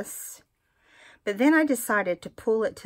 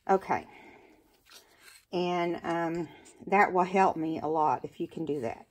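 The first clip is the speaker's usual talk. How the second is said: normal talking voice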